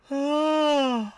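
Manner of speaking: loud, high yawning sigh; real yawn. Real, not acted. Streamer tired mid-stream, loud yawn. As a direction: let out a big, high yawn. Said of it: "I don't know why I'm yawning".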